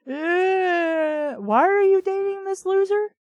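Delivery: loud, high cry of disbelief; exasperated outburst. Real, not acted. Reader yells at the character in disbelief. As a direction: shout your disbelief at the page.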